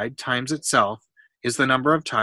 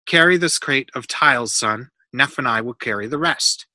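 ordinary delivery

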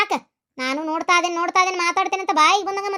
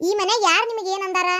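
usual speaking voice

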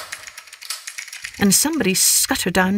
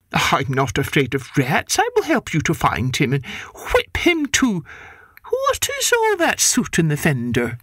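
stern neighbour cat; 2 semitones lower than usual. Acted. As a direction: scold like a stern aunt.

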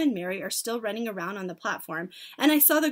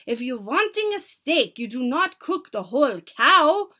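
loud, higher scolding; shouted; 4 semitones higher, 8 dB louder than usual. A narrator's reading. Grand High Witch rebuking her audience, shouted. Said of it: "shouted the Grand High Witch".

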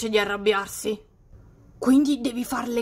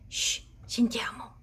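quiet shushing whisper; 7 dB quieter than usual. Real, not acted. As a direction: hush everyone in a whisper. Hiding while prank unfolds.

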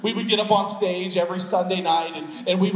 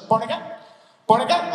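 "Born again" quick and slurred into one word; run together as one word; 3 semitones higher, faster than usual. Retold or imitated. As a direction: rattle off "Born again" with no gap between words. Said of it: "Like, it's one word".

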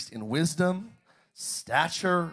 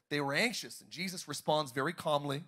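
ordinary delivery

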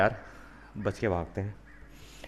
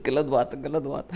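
laughing through the words; 3 semitones higher than usual. Real, not acted. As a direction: scold while still laughing. Laughing after the zombie joke. (हँसते हुए डाँटना)

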